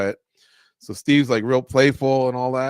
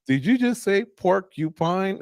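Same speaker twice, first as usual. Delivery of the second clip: high, slow disbelief; incredulous; 8 semitones higher, slower than usual. Retold or imitated. Game show host baffled by an answer, incredulous and high. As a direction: ask it like you can't believe your ears.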